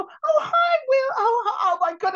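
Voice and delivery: high, loud mock squeal; starstruck fan gushing. Nobody at the table greeting Will Smith, mock starstruck squeal. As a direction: gush like an overwhelmed fan.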